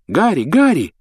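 high, loud excited call; eager calling. A narrator's reading. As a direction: call out to a hero. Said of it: "позвал Колин Криви".

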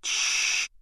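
soft, voiceless shush; hushing shush. Acted. Hermione silencing Harry as they hide, hushing. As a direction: hush him quietly.

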